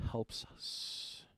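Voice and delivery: breathy whispered emphasis; hushed whisper. Acted. Pastor telling church the Spirit helps, whispered. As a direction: drop to a whisper to land it. Stresses Paul's word helps.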